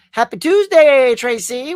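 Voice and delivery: big loud happy hello; loud greeting. Real, not acted. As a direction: call out the greeting.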